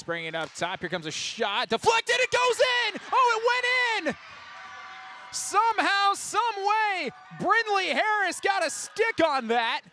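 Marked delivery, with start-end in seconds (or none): 1.7-9.8 loud, high-pitched shout of disbelief; excited goal call. Real, not acted. Commentator calls a deflected goal for the crowd, wildly excited. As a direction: erupt as the puck goes in.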